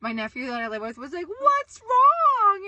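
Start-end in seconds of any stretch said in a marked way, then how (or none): 1.3-2.7 high, loud, drawn-out call; imitated alarmed shout. Imitating her nephew reacting to screams, alarmed shout. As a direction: yell it as a worried nephew from another room.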